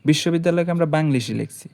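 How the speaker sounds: normal talking voice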